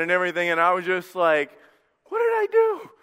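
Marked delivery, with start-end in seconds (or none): 2.0-2.9 much higher, squeaky baffled question; a stunned young groom asking what happened; bewildered. Retold or imitated. Groom telling the crowd he's baffled, in a high squeak.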